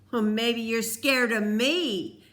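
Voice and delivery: put-on bully daring the other boy; taunting Peter. A narrator's reading. Peter taunting Cole, sneering and loud. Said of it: "Peter said".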